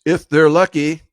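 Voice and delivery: emphatic. Real, not acted. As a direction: hit it hard and high as a pointed afterthought. Emphatic first take, then slower repeat.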